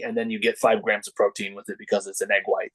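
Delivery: ordinary delivery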